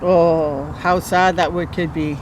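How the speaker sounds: plain reading voice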